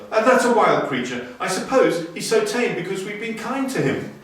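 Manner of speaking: a mother marvelling at the tame animal; surprised mother. Acted. Mother watching the mongoose, lightly voiced surprise. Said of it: "said Teddy's mother".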